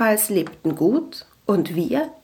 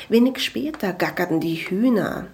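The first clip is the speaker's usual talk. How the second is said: usual speaking voice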